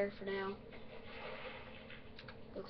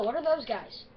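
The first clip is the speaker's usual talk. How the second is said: usual speaking voice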